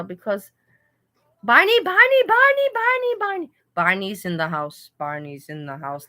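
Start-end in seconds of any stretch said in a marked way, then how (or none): 1.4-3.5 put-on squeaky high childlike calling; high kid voice. Greeting viewer Barney in a high kid voice. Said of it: "my high kid voice". 5.0-6.1 quieter take. Shown as a demonstration. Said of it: "let me put it down".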